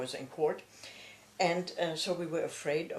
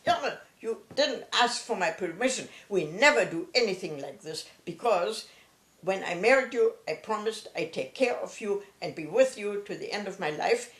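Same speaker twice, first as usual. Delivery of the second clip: retelling father's outburst, higher and louder; imitating a husband who flares up at his wife; furious outburst; 4 semitones higher, 6 dB louder than usual. Father refuses his wife's offer of divorce, imitating his loud furious outburst. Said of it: "father really lost his, um, uh, his mind almost".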